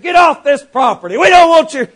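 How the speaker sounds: an angry husband shouting off the preacher; angry order. Retold or imitated. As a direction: run him off the porch.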